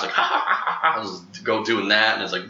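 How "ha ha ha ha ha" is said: mimicked laughing bird call; imitated bird laugh. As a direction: do a bird's ha-ha cackle. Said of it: "the bird that, like, laughs".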